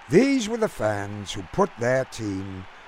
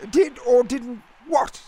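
put-on high, puzzled English gent's accent; lofty British accent; 12 semitones higher, 3 dB louder than usual. A narrator's reading. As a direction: ask in baffled, clipped British tones. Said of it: "in his lofty, clipped accent".

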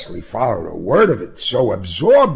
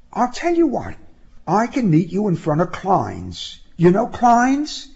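high-pitched woman's voice making plans; high, lively proposal ending in a question; eager young woman; 9 semitones higher than usual. Acted. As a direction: suggest it brightly in a high voice. Woman proposes meeting place, voiced by narrator.

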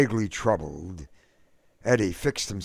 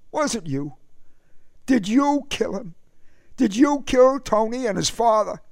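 high, rising, louder accusation; accusing; 4 dB louder than usual. A narrator's reading. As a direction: confront your brother head-on. Eddie accuses his brother.

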